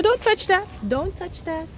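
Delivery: ordinary delivery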